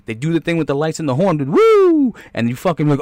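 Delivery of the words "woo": high loud woo like a cop siren; mock siren whoop. Cop lighting up a speeder, excited whoop. Cops hit lights and horn.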